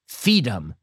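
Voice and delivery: put-on "Feed 'em" as a child's "freedom" missing its R; childish mispronunciation. Little girl answering the policeman, childish "Feed 'em". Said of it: "She couldn't even pronounce it".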